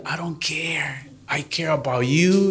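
hushed, slow whisper; tender whisper. Acted. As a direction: breathe it softly, like a secret promise. Tender whisper to the one who mistreats.

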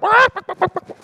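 loud high burst of laughter; real laugh at his own joke. Real, not acted. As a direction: crack up at the punchline.

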